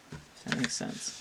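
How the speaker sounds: murmured under her breath; whispered aside. Real, not acted. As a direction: toss it off in a whisper. Muttered aside to herself.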